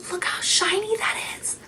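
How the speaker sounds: breathless whisper, in awe; awed whisper. Real, not acted. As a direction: whisper it, starstruck by the shine. Admiring the gloss closely.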